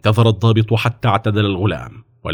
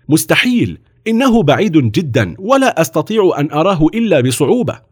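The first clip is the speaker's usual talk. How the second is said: higher-pitched mocking disbelief; scornful; 7 semitones higher, 3 dB louder than usual. A narrator's reading. Officer scoffing at the boy's claim, mocking. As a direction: dismiss it as impossible, with scorn. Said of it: "وقال الضابط في سخرية".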